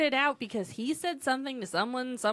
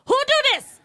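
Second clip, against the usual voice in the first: put-on high, loud broken-English Vietnamese accent with a laugh; mock Vietnamese accent; 9 semitones higher, 11 dB louder than usual. Nail lady scolding a client for going elsewhere, mock Vietnamese accent. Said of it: "that's how she sounded".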